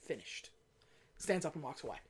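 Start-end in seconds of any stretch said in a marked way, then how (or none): 0.0-0.5 low, soft, final word; curt Bond. Retold or imitated. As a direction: drop it quietly and walk off. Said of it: "he stops and he goes".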